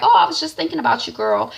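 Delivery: gushing mom. Retold or imitated. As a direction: rush it out delighted. Cassie voiced gushing.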